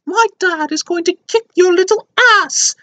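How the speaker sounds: very high put-on British child voice; mock a small British boy boasting; hammed-up squeaky little British kid accent. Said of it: "he drops his British accent completely".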